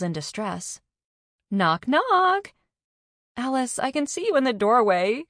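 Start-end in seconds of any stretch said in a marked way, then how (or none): 1.5-2.5 high, loud sing-out of knock, knock; yelled. A narrator's reading. Alice announces herself in the doorway, yelling. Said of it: "You didn't need to yell". 3.4-5.2 higher, amused tone; lightly amused, pitched up; laughing. A narrator's reading. Carney teases the friend standing in the doorway, lightly laughing. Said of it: "Carney laughed".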